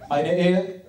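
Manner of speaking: slow, heavy broken-German father-in-law; Turkish father accent. Retold or imitated. The father-in-law lecturing his new son-in-law, slow and grim. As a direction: deliver it like a grim old patriarch.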